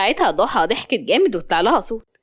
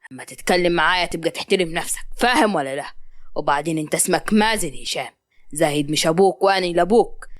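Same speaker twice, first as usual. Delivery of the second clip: deep-pitched, controlled warning; stern father; 6 semitones lower than usual. Acted. Hisham warns Mazen to respect his father, stern. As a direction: lay down the law in a low voice. Father threatens son after second slap.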